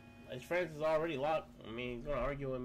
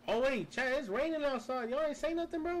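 surprised; 10 semitones higher, 5 dB louder than usual. Real, not acted. Surprised it is raining.

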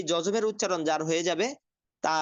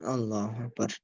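distant background call; 6 semitones lower, 4 dB quieter, slower than usual. Real, not acted.

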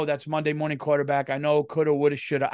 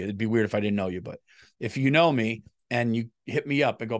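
ordinary delivery

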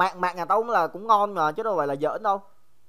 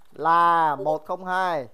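slow reveal; 3 semitones lower, much slower than usual. Shown as a demonstration. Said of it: "slow reveal of answer".

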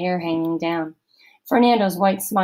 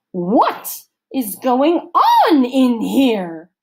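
loud, slow, higher demand in a counselor's voice; 6 semitones higher, 7 dB louder, much slower than usual. A narrator's reading. Missus Periwinkle bursts into the cabin, loud slow demand. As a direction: demand an answer slowly and loudly. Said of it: "she demanded".

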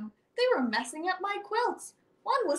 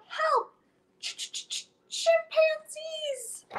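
much higher panicked cry, stretched stutter slow; shouted for help; 11 semitones higher, much slower than usual. Acted. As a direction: call for help, stammering on chimpanzees. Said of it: "as I shouted out".

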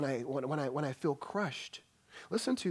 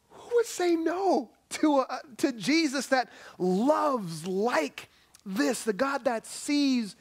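higher, louder and slow, pleading with the congregation; impassioned appeal; 10 semitones higher, 5 dB louder, slower than usual. Acted. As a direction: build to the altar call. Sermon climax appeal.

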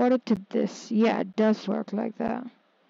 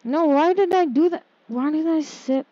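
high, loud self-reproach; exasperated outburst at own blunder; real dismay; 6 semitones higher, 5 dB louder than usual. Real, not acted. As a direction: scold yourself in a raised, higher voice.

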